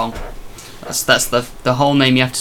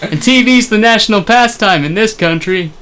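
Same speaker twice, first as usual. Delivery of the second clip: high-pitched mock American voice; American accent; 6 semitones higher than usual. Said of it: "do our very own American accents".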